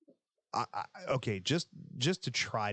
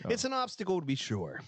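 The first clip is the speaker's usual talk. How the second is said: mock formal; 10 semitones higher than usual.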